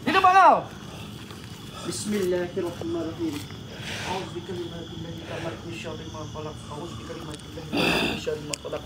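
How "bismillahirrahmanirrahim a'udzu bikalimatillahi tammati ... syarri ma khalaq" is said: low, hushed prayer under the breath; muttered bismillah; murmured prayer. Real, not acted. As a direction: murmur it as an invocation. Healer recites protection prayer.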